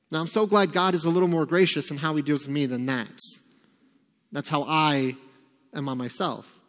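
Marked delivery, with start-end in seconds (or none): none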